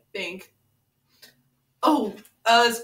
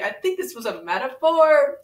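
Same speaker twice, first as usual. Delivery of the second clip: usual speaking voice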